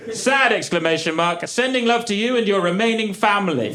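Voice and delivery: high-pitched, emphatic mock dictation; mock-exclaimed message. Performs Keith's blunt condolence message.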